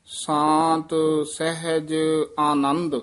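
ਸ਼ਾਂਤ ਸਹਿਜ ਆਨੰਦ read slowly, with a short stop after Anand; teacher shows the student where to pause, with a short stop after Anand. Shown as a demonstration. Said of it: "ਇੱਥੇ ਠਮਕ ਲਾ ਦੇਣੀ ਹੈ".

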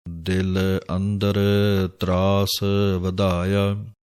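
slow, drawn-out chanting of the verse; scripture recitation. Acted. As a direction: intone each word slowly like a reading of Gurbani. (ਹੌਲੀ ਹੌਲੀ ਬਾਣੀ ਦਾ ਪਾਠ)

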